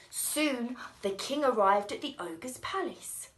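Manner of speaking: ordinary delivery, nothing marked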